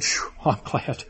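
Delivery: breathy whispered relief with a little laugh; relieved whisper. Retold or imitated. Little boy whispering relief to his big sister. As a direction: let it out as a soft whispered phew.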